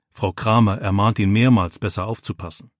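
ordinary delivery

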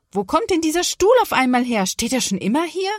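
high, loud, indignant complaint; annoyed exclamation. Acted. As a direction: complain crossly after a painful bump. Mother complains after hitting chair. (ärgerlich, hoch und laut schimpfen)

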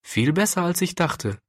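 pleased, lifted narrator's voice; joyful. A narrator's reading. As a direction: share good news with understated joy. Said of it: "sagte sie freudig".

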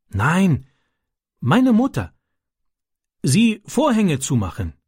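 a hint of a young foreign boy telling his worry; Ram's childlike broken German. Ram answers Francis.

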